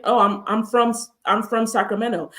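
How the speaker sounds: usual speaking voice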